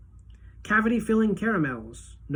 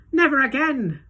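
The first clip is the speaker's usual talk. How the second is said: gasped; 9 semitones higher, 6 dB louder than usual. A narrator's reading. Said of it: "gasped Missus T V".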